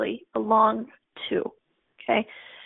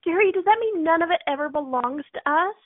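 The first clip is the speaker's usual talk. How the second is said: higher-pitched mock call from the audience; retold crowd shout; 6 semitones higher than usual. Student calling to the founder from the crowd, mild mock yell. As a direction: call out the question without really shouting. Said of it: "I screamed from the crowd".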